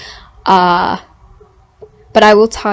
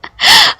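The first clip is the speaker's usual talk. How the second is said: high squealing giggle; 6 dB louder than usual. Real, not acted. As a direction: burst into a giddy laugh. Giggling at own reaction.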